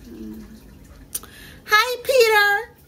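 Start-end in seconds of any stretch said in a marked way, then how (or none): 1.7-2.8 a bright, high, loud greeting; father greeting his son, high and loud. Acted. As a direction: call out a cheerful hello, pitched up. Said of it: "said his father".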